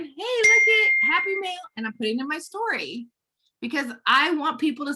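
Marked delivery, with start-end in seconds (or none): 0.0-1.6 high sing-out to an audience; high-pitched, playful announcement; calling out. Acted. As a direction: show it off brightly for a story video. Acts herself filming at post office.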